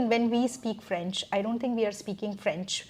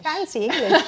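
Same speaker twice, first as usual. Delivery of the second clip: high, loud, through laughter; delighted, laughing agreement; laughing echo; 5 semitones higher, 5 dB louder than usual. Real, not acted. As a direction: repeat the joke while laughing. Echoes the listener's joke, laughing.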